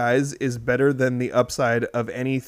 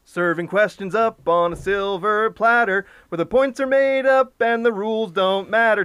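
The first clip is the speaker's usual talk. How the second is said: high announcer jingle read; 7 semitones higher than usual. Acted. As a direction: introduce the segment like a host. Show segment intro.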